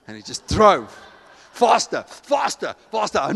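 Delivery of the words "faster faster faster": building mock panic. Man flees from God's prompting.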